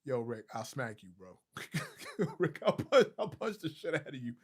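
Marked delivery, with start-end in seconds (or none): 1.5-2.3 high squealing laugh at a chat comment; burst of laughter. Real, not acted. Cracking up at Rick's comment, high laugh. 2.4-4.3 laughing, half-joking threat; mock threat through laughter. Real, not acted. To chatter Rick, a laughing mock threat.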